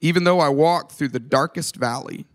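slow psalm reading with long pauses; very slow, measured recitation; scripture reading. Real, not acted. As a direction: read it reverently, letting each phrase settle. Reads Psalm 23 aloud.

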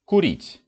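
Курить said slowly and clearly, stress on the ending; teacher says Курить once for the class; Russian word demo. Shown as a demonstration. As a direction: model Курить for learners, stressing the last syllable. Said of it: "The form курю is stressed on the ending".

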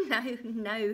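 gleeful chuckle into a loud no-no; chuckling refusal. A narrator's reading. Little man laughs at her guess, chuckling. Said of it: "he chuckled".

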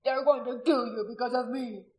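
loud, anguished tearful voice; weeping. A narrator's reading. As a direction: cry out in guilt through tears. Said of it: "he wept".

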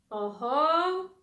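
loud, high exclamation of delight; joyful call. Acted. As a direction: call out to the whole family. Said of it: "zawołał tata". (radosne głośne zawołanie)

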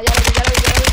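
loud, urgent callout. Real, not acted. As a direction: call it out to the squad. Spotting an enemy. (aviso a gritos)

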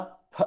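soft isolated फ sound; a quiet spoken फ; sound demo. Shown as a demonstration. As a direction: breathe out a quiet फ. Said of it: "उसको जो मैंने बोला".

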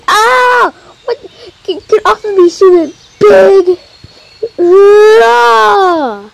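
high, shrieking cry at the pig; mock scream. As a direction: shove the pig off in fake panic. Character attacked by pig.

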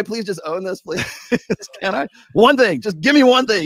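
loud, high joking plea; pleading. Real, not acted. Guest begging the streamer to let one thing go, loud and high. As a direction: beg loudly for one break.

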